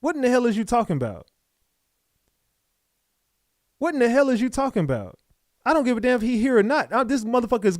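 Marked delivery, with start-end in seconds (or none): 0.0-1.3 exasperated. Real, not acted. Host reacts to the interviewee's story. 3.8-6.6 exasperated disbelief. Real, not acted. Host repeats the same reaction.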